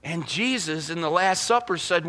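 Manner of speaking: ordinary talk, nothing marked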